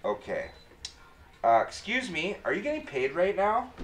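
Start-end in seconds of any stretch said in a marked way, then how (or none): 1.4-3.7 high, polite nervous question; a shy kid asking politely; timid teen voice. Retold or imitated. Teen asking tattoo artist.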